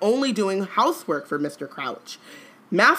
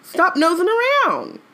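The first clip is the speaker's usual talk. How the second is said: high, loud mock scold; scolding elf voice; 11 semitones higher, 4 dB louder than usual. Teasing Harry in Winky's scolding voice, high and loud. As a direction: jab at him like a cross elf.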